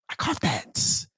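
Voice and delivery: breathy whisper of a gleeful catch; soft, voiceless I caught that; whispered aside. Real, not acted. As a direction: whisper it to yourself.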